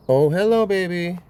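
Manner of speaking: high, loud cooing hello. Real, not acted. Greets the neighbor's cat.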